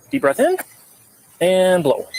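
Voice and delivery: high, drawn-out coaching cue. Real, not acted. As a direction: call out the breath cue to guide. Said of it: "So you gotta blow hard".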